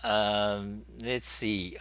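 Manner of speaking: ordinary talk, nothing marked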